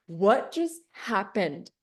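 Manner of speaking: slow, dazed and bewildered; stunned disbelief. Retold or imitated. Alone after hanging up, slow stunned disbelief. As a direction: let the shock slowly sink in. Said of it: "And I'm like".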